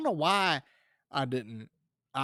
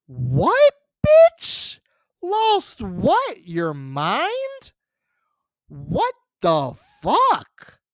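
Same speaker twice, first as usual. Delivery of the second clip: incredulous retort; 8 semitones higher, 7 dB louder, much slower than usual. Acted. Incredulous retort in acted quarrel.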